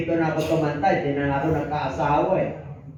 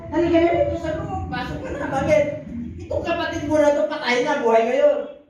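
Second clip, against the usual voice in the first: pleading father; 10 semitones higher than usual. Acted. Father pleads with elder son.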